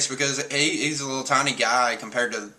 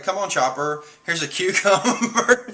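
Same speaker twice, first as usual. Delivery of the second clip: mock coaxing; 7 semitones higher, 3 dB louder than usual. Fake Robin luring Chopper with a cucumber, mock coaxing. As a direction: coax lightly with a laugh, stretching it out. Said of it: "She has to lure Chopper in".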